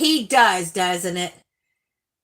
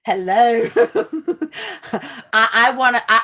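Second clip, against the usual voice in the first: ordinary talk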